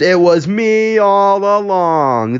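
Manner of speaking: high, slow grand confession; dramatic exclamation. Acted. Humphrey confessing to the whole table, exclaiming dramatically. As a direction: draw out a theatrical reveal at high pitch.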